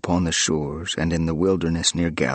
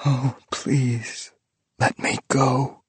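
mournful plea; 5 semitones higher, slower than usual. Acted. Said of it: "and mourned".

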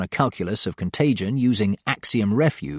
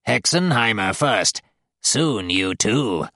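gloating demon; slower than usual. A narrator's reading. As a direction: gloat over the captive. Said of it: "Matron gloats at me".

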